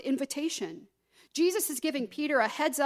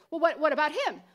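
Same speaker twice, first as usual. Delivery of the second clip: higher, louder, hurried questions; pestering, pitched-up string of questions; mock nosy questioning; 4 semitones higher, 4 dB louder, faster than usual. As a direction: imitate someone deflecting onto others. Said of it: "look around at other people and be like".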